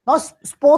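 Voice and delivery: स्पो said high and loud as the mistake; mock wrong spo. Shown as a demonstration. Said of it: "स्पो क्यों आ रहा है".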